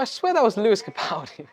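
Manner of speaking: high-pitched excited double take; mock astonishment. Telling friend he spotted a famous singer, excited. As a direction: retell a startled celebrity sighting, pitch jumping up.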